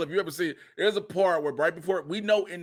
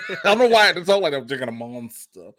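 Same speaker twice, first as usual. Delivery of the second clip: fast, laughing at himself; 2 semitones lower, 7 dB louder, faster than usual. Real, not acted. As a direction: laugh through the line.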